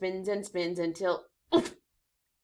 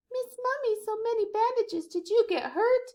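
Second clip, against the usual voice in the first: put-on much higher, small child voice; child monster voice; 4 dB louder than usual. Little monster asks Miss Mummy, high and childlike.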